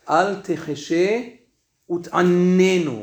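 slow recitation, UT'ANENU with a doubled first n; dagesh in nun. Shown as a demonstration. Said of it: "That has a dagesh in the nun".

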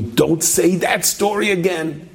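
high, slow mock scolding voice; a hammed-up high warning voice; teleprompter voice. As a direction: play the nagging teleprompter, high and drawn out. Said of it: "The teleprompter is telling me".

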